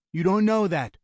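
quick, high-pitched rebuttal; insistent objection. A narrator's reading. Brother contradicting the gunman's despair, urgent objection.

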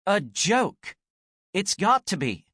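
high, pleading desperation; clinging to hope that it's a joke; desperate. Acted. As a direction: Anne begging it to be a joke, desperate. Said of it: "Desperation was creeping into her voice".

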